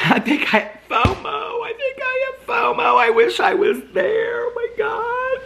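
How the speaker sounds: high, wailing fake sobs; mock crying. Jealous of friends at BravoCon, hammed-up wailing sobs. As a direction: ham up a jealous meltdown. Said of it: "that whole first part was all a joke".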